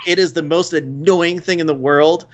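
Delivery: ordinary talk, nothing marked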